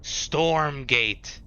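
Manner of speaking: normal talking voice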